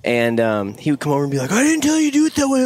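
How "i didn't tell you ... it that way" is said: high, fast, scolding boss voice; angry farmer. Retold or imitated. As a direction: snap at the workers like a cranky farmer. Retold angry farmer complaint.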